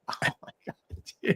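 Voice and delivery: breathy whisper under his breath; a hushed oh-my-God to himself; whispered aside. Retold or imitated. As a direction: whisper it to yourself, stunned. Muttering relief after the radio call.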